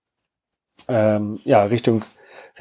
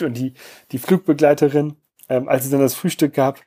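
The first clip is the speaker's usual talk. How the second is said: ordinary talk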